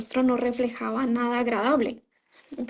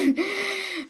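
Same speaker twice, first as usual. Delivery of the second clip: nervous laugh; 8 semitones higher, 4 dB quieter than usual. Real, not acted. Disbelief after reading sect pages.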